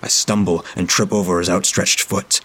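plain reading voice

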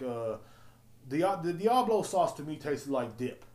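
usual speaking voice

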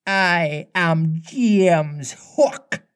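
mimicked pirate voice, stately and stretched; Hook. A narrator's reading. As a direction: announce yourself as the captain, drawn out. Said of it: "answered in Hook's voice".

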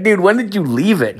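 high-pitched, amused disbelief; incredulous question. Retold or imitated. Podcaster quizzing coworker about quitting the marathon, incredulous. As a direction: ask it high, as if you can't believe it.

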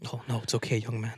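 put-on soft, low weak old man; very old faint voice. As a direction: mumble it like a frail grandpa. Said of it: "pretend you're old".

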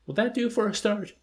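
bright eager question, pitched well up; eager offer. A narrator's reading. Milo eagerly pitching a feast to the major, bouncing up high. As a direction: leap up high, keen to please.

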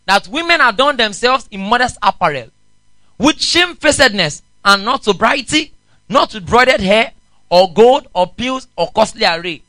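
loud, ringing Bible reading; scripture reading. Acted. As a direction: proclaim the verse to the congregation. Preacher reads scripture aloud.

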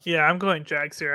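ordinary delivery

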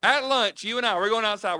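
high, forceful start, easing off; retold yelling. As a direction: holler the order over the noise. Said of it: "I'm over there yelling at him".

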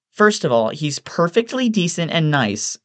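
high-pitched indignant woman; defensive Evelyn. A narrator's reading. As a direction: stand up for him in a high voice. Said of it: "Evelyn says in his defense".

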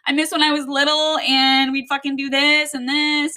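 high, half-teary venting to her fiance; retold upset outburst. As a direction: quote herself as the upset one. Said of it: "And I'm like".

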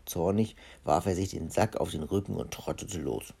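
usual speaking voice

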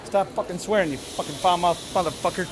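normal talking voice

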